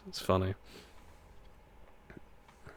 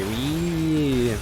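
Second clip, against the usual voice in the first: long, higher gleeful "Whee!"; playful whee; 5 semitones higher than usual. Pretend. Streamer agreeing with a viewer's woohoo, playful cheer. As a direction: stretch it out like a ride. Cheering while racing the track.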